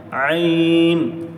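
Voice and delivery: عین held long from the middle of the throat; drawn-out ain demo. Shown as a demonstration. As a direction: stretch عین slowly for the class. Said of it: "بس وہیں سے عین".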